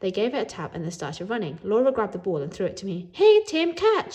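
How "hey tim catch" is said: high, loud shout. Acted. Laura shouting to Tim to catch, high and loud. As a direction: yell it across the field. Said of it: "she shouted".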